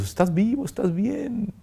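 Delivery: family checking he's alive, voice pitched high; imitating worried siblings, high and urgent; relatives' anxious questions. Family greeting the freed priest, high anxious voice. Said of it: "y ellos".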